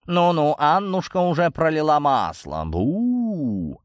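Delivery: high, teasing cat voice; mocking cat. A narrator's reading. Cat teases Ириска with a spooky joke, mocking and high. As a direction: taunt playfully, ending on a long, high spooky boo. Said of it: "засмеялся сгусток меховой тьмы".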